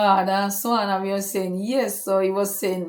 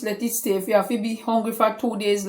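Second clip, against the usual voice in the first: normal talking voice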